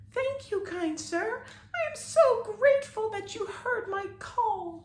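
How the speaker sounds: very high, gentle grateful fairy; fairy thanking her rescuer; tiny fairy voice. A narrator's reading. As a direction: thank the boy sweetly and tinily.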